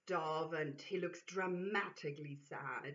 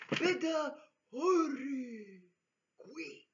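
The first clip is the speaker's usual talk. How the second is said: slow, higher, odd Yeti voice; Neanderthal Yeti voice; 7 semitones higher, 7 dB louder, slower than usual. Acted. Yeti urges the sleeper to hurry, slow and strange. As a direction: drag each word out like a wild Yeti. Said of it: "in a deep Neanderthalic voice".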